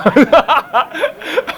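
bright high burst of laughter; real amused laugh. Real, not acted. Laughing at the memory.

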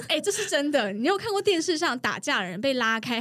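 ordinary delivery

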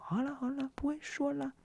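high, soft mock-soothing tease; teasing coworker. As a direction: play the smug coworker humouring her.

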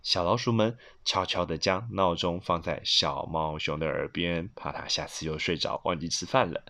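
low, soft, secretive narration; hushed storytelling. A narrator's reading. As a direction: tiptoe through the words. Narrating mice sneaking past the sleeper.

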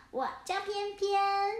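high, slow, cute little voice; little penguin. Acted. Little penguin telling the cats its name, high and sweet. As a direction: say your name sweetly like a small child.

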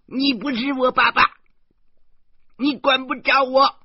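put-on a stubborn child yelling back; defiant child's shout. Girl yelling at her guardian from the railing, defiant. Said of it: "小魔女又叫道".

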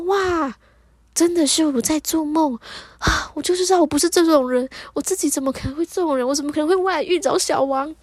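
wowed inner exclamation; amazed realisation. Retold or imitated. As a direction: act out a dawning discovery, voice lifted. Realising she is only dreaming.

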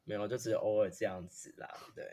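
ordinary talk, nothing marked

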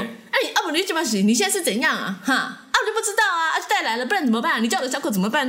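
high, hammed-up auntie confronting; angry auntie. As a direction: play the furious auntie storming over. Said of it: "那个阿姨就，就是走过去就说".

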